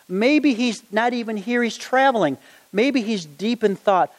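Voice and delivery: high, teasing jeer at the prophets; mock them, enjoying it; mocking taunt. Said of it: "Elijah is really starting to have fun".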